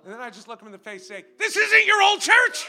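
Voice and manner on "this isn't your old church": loud, slow retold shout at a complaining churchgoer. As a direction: bark it back, slow and loud, higher. Pastor retells rebuking newcomer.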